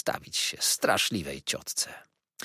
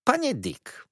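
high-pitched address, falling at the end; calling to attention; slower than usual. A narrator's reading. Aunt starting to address Mr. Dick, calling him to attention. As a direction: call him to attention, high then dropping.

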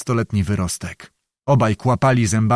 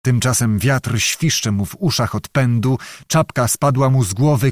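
usual speaking voice

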